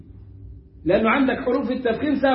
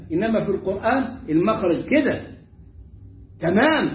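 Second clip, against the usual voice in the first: ordinary delivery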